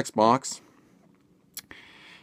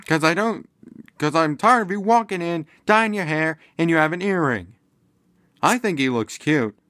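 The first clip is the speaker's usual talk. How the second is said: mock son reply; 7 semitones higher than usual. Son talking back to mom.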